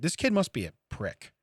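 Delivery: usual speaking voice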